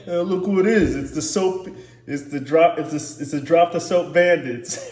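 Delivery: big inmate teasing. Acted. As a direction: taunt the new cellmate, hammed up.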